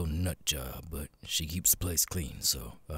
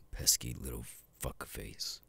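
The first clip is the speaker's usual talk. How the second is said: muttered insult; 4 semitones lower, 7 dB quieter than usual. Acted. Insults his keeper under his breath.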